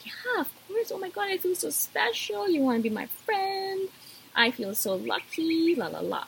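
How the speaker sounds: squealing high and gushy, over the moon; gushy eager friend. Pretend. Answering a new friend's request, high-pitched and giddy. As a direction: gush like a giddy kid who was just picked as a friend. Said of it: "I don't know why I started talking like that".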